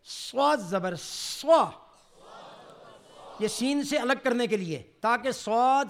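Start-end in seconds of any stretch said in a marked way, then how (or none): 0.0-1.7 slow سوا with a full-mouthed hissing ص; heavy saad drill. Shown as a demonstration. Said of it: "سواد کی مشق کریں".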